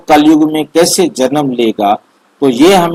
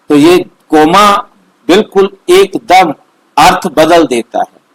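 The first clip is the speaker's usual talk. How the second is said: usual speaking voice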